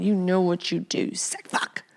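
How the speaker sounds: hushed, knowing whisper of contempt; menacing whisper. Real, not acted. Host to secret booger eaters, contemptuous whisper. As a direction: lean in and hiss it softly.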